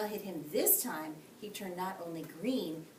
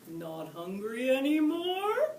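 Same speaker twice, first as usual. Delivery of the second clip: chuckling; 6 semitones higher, 7 dB louder, slower than usual. A narrator's reading. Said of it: "Mucky chuckled".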